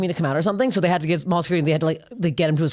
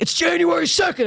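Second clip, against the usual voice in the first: high-pitched comic burst; mock excited announcement; 12 semitones higher than usual. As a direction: blurt it out like a manic punchline. Acts out comic riff on sneaking.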